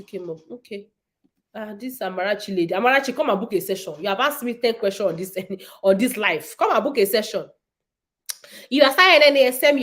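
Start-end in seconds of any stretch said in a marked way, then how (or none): none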